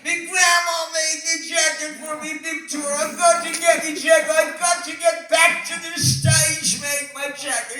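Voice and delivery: [name] screaming. Retold or imitated. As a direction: yell like a frantic English rocker after his jacket. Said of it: "screaming and shouting".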